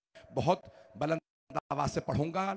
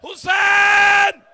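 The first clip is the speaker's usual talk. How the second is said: full-force majlis preaching; 10 semitones higher, 14 dB louder than usual. Real, not acted. Preacher pouring the grief of the martyrdom over the mourners, loud and high. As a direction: cry out the mourning lines to the whole gathering.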